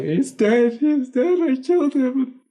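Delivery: very high mock wailing; bawling, freaking out. Brother sure he killed his sibling, hammed-up high bawling. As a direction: imitate a panicked, bawling kid in a high squeal. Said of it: "he's just bawling and freaking out".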